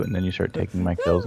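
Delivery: ordinary delivery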